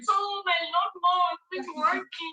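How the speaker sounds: high, drawn-out whiny voice; mock whiny beggar. Mocking influencers who beg online, high whiny voice. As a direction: drag it out like a needy poster begging. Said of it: "be like".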